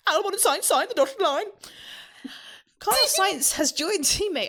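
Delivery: high sing-song headline; announcer headline. Acted. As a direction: proclaim it high like a segment title. Reads segment headline as announcer.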